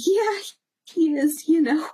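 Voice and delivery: retold high-pitched, awkward agreement; flustered yes. Fan answering celebrity's question about her husband, flustered. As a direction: answer too high, caught off guard.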